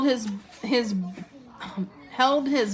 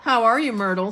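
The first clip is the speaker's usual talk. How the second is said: high, loud start of forced cheer; fake-friendly hello; falsely bright; 4 semitones higher, 6 dB louder, faster than usual. A narrator's reading. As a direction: greet with put-on brightness. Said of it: "in a falsely bright voice".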